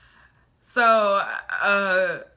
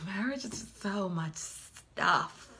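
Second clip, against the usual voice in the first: weary sigh; 8 dB quieter, much slower than usual. Retold or imitated. Sighed inner thought about marriage.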